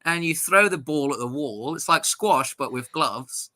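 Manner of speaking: put-on posh English friend explains the old Etonian game. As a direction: patiently explain Eton fives in a light posh English voice. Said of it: "And he's like".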